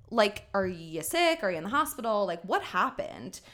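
ordinary talk, nothing marked

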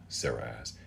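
plain reading voice

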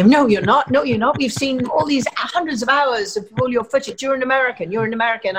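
mock the bosses brushing her off; imitating network executives' disbelief; insistent disbelief. Network heads insisting she's American.

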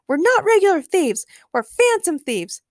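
high, loud indignant correction; emphatic shout. A narrator's reading. Cat creature corrects Jesse, shouting emphatically. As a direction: insist in a high, forceful voice. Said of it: "the cat-like creature shouted emphatically".

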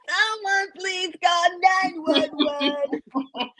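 high, slow mock panicked plea; laughing imitation of a shot man. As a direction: ham up a desperate cry. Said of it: "sound like he really was shot".

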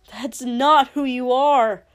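loud, intense hiss; fierce, insistent denial. Acted. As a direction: force it out as an angry hiss. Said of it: "Shoto heard himself hiss".

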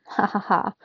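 stiff, spaced-out mechanical ha ha ha; a fake laugh from a robot trying to seem human; weird robot laugh. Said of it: "Her weird laugh creeped me out".

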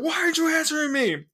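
high, strained mock yell; exasperated interrogator. Agent reaching the last hostage, exasperated high yell. Acted interrogator loses patience.

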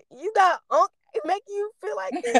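high, teasing friend voice; quoting a friend. Retold or imitated. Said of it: "he said".

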